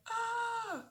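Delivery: very high startled shriek; mock terrified scream; imitated scream. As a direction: scream like the jump scare got you. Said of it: "You're like".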